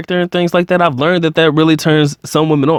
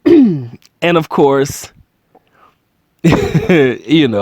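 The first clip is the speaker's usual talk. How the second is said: laughing; much slower than usual. Real, not acted. Laughs, avoids saying more.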